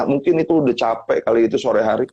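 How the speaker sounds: normal talking voice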